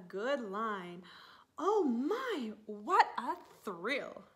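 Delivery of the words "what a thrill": ordinary delivery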